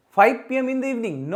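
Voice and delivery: wrong example, emphatic. Shown as a demonstration. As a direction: call out the mistake loudly.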